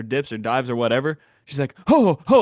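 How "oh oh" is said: mock panic. Nervous seatmate during takeoff.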